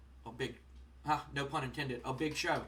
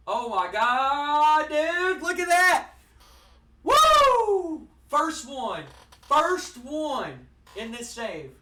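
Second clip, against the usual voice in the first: much higher and louder, real elation; a much higher, louder cheer at a perfect score; thrilled shout; 10 semitones higher, 13 dB louder, much slower than usual. Real, not acted. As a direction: scream with joy at the hundred. First score of a hundred.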